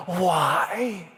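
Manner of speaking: acted plea flung up to God; crying out. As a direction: wail it as one long word. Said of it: "he cries out to God".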